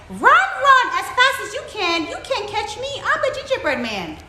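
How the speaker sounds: high, loud taunt, easing at the end. A narrator's reading. Gingerbread man to hard-of-hearing fox, very loud. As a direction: belt out the boast for poor ears. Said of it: "in a very loud voice".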